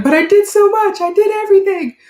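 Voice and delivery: high, loud wail of an unappreciated parent; exasperated protest. Acted. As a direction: plead your case, high and loud. Parent protesting to grown kids' complaints.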